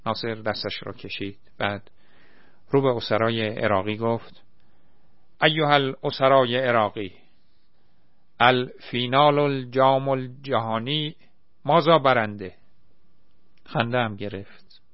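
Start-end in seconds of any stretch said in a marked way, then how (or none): 5.4-12.5 broken mock Arabic. A narrator's reading. Naser loudly asks the Iraqi prisoners in broken Arabic. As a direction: address the prisoners in made-up Arabic. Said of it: "خیر سرش مثلاً عربی حرف زد".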